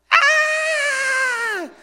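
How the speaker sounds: a shrill, piercing, very high and loud shriek; highest-pitch scream. Retold or imitated. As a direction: let out a startled top-of-the-range scream. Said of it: "he yells in this highest".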